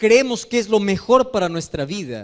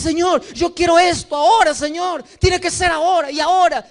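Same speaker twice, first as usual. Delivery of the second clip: higher, louder impatient demand; insistent demand; 6 semitones higher, 4 dB louder than usual. Retold or imitated. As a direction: push God like a nagging child. Believer insists on now.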